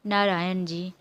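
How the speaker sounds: usual speaking voice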